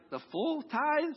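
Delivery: slow, high, stretched "The full tithe?" in mock shock; incredulous, drawn-out question; mock disbelief. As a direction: drag it out like you can't believe it. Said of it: "you're like".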